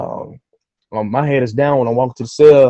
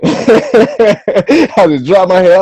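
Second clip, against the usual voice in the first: laughing, slow; 7 semitones higher, 8 dB louder, much slower than usual. Retold or imitated. Laughing retelling of defeat.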